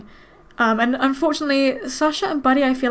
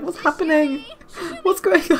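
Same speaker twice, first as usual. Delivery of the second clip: confused dog voice; 3 semitones higher than usual. Retold or imitated. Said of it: "She's like".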